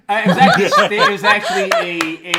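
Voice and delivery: loud, laughing through the words. Real, not acted. Judge laughing while inventing a trivia answer. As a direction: giggle out the made-up answer.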